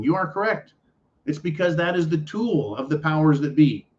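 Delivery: ordinary talk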